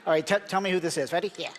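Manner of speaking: usual speaking voice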